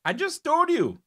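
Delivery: exasperated. Real, not acted. Exasperated, high and loud.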